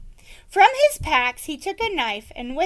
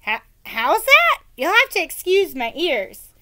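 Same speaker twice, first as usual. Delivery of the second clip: put-on high, loud old woman; a raised, hard-of-hearing village woman; 6 semitones higher, 6 dB louder than usual. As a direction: play the half-deaf old woman, higher and louder. Old woman voiced in story.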